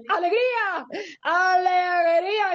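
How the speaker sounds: high, stretched cry of delight; gleeful, lengthened cheer; joyful exclamation. Real, not acted. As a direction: burst out with it, dragging the vowels. Triumphantly finds the word sought.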